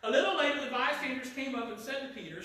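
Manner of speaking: normal talking voice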